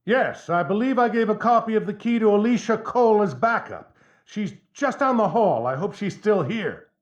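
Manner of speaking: urging through door. Acted. Trapped judge urges her through door.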